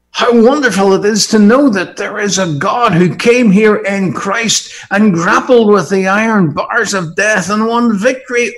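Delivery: high, loud exultant preaching; triumphant preacher proclaiming loudly; full-force preaching. Acted. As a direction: proclaim the victory over death to the hall.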